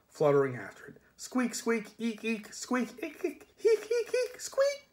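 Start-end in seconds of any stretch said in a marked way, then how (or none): 1.2-4.9 squeaks climbing ever higher into giggles; squeaking rat chatter. Acted. The Death of Rats greets Susan with rising, giggling squeaks.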